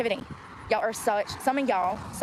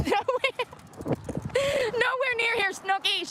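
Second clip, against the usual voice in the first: teasing reply voice; 12 semitones higher, slower than usual. Pretend. Answering own pretend passenger.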